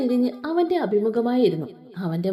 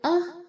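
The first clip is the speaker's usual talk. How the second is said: yelp of pain; 7 semitones higher, 4 dB quieter than usual. A narrator's reading. She bites his shoulder.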